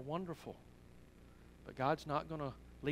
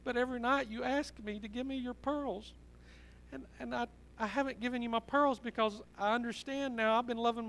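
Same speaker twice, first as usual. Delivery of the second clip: put-on high, pleading voice of an upset little girl; retold tearful confession, pitched up and pouring out; tearful child; 7 semitones higher than usual. Six-year-old confessing to her daddy through tears, tearful and high. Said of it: "she was crying, little six-year-old tears".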